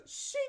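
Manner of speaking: hushed imitation of a quick stab; soft blade sound. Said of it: "not even like a hard sound".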